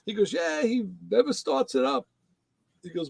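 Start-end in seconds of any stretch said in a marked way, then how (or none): 0.3-2.1 put-on high light Jamaican accent; a hint of the Jamaican mailman. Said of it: "So he got this Jamaican accent".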